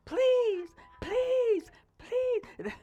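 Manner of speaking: high soulful singer's wail on each please. Retold or imitated. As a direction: belt it like a soul singer begging. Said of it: "Any of y'all remember [name]?".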